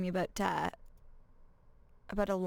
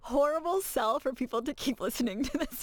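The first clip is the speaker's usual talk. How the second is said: laughing; 3 semitones higher, 8 dB louder than usual. Real, not acted. Laughing while teasing the host.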